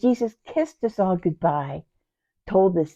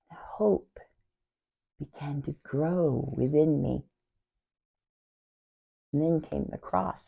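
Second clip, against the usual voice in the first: hushed recollection; 2 semitones lower, 9 dB quieter than usual. Acted. Sister recalls hope growing before the cross, hushed. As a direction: sink into a hush before the blow.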